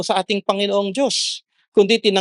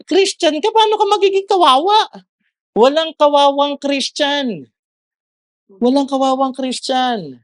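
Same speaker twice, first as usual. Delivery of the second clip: emphatic exhortation; 8 semitones higher, 4 dB louder than usual. Real, not acted. Preacher rebuking self-pitying Christians, emphatic exhortation. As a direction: call it out high to the congregation, stretching the words.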